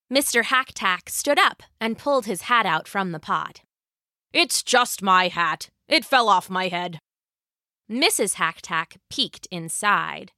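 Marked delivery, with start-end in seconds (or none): 4.3-7.0 unexcited low husband voice; old husband. Acted. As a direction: explain it simply in a lowered man's voice.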